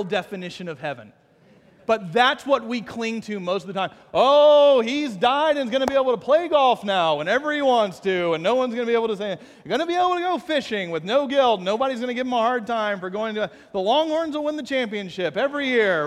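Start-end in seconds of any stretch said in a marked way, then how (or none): 4.1-16.1 mock comfort. Mock voice of people grieving.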